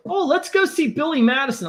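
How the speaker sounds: a touch of his wife's eager pitch; imitating wife. Wife suggests seeing Billy Madison, lightly mimicked eager voice.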